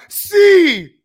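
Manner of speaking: loud, high sudden yell; re-enacted heckling shout. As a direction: blurt it out at full volume. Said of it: "I would just yell out".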